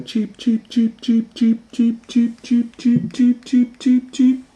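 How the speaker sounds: high, even, staccato cheeps; baby birds cheeping. Acted. Newly hatched babies calling, high repeated cheeping. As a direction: chirp rapidly like hatchlings. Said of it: "little babies came out and were going".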